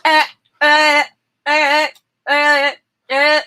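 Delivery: short mock grunts, looped; imitated repeated grunts. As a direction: copy the clipped edit loop, eh eh eh. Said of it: "over and over the same line".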